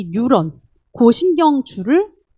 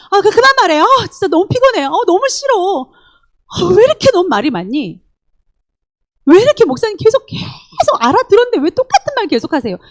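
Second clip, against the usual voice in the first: whiny irritation; 7 semitones higher than usual. Retold or imitated. Fed-up listener whines at the pastor to stop repeating, high. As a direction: whine that you've heard enough.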